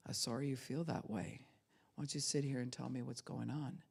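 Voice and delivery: low, soft, soothing; calming. Acted. Listener inviting an upset person to talk, calming. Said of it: "Until there's a calming".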